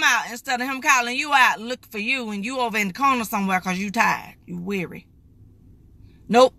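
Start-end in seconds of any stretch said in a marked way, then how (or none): none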